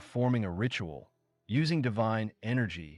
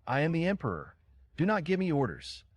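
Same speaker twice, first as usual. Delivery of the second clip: higher, furious shout at his mother; angry scream; 7 semitones higher, faster than usual. A narrator's reading. Emperor screams at his mother to stop giving orders, rising fury. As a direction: assert the crown, voice climbing. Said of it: "screamed loudly at her".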